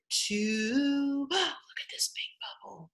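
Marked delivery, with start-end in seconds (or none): 1.3-2.9 awed little whisper. Acted. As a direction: gasp then whisper. Marvelling at picture with children.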